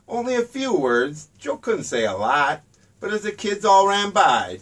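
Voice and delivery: plain reading voice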